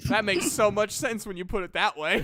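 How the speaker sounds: says it through laughter; laughing at his own mistake. Retold or imitated. Telling players about his dice mistake, laughing. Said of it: "I'm like".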